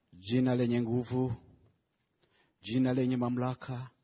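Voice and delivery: measured prayer with long pauses between phrases; solemn prayer. Real, not acted. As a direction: pray phrase by phrase, pausing after each.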